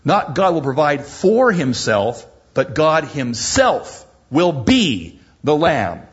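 preacher's emphatic contrast; contrast stress. Shown as a demonstration.

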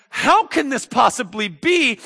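high, loud disbelief; incredulous. Acted. Zechariah hears the promise go out, incredulous. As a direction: question it at the top of your voice.

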